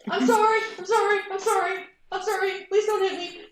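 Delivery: high, loud, fast frantic sorries; panicked apologies. Acted. Pilot cowering before the stern officer, frantic and pleading. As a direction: cower and beg.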